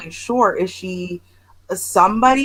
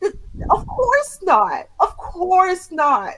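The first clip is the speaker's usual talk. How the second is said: high, slow, drawn-out disbelief; incredulous refusal; 10 semitones higher, slower than usual. Real, not acted. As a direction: stretch it out high, utterly appalled. Incredulous answer to absurd request.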